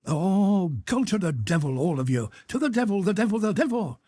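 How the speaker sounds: a flustered father driven past his patience; exasperated outcry. Acted. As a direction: fling the curse out in exasperation. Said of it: "cried the old Count".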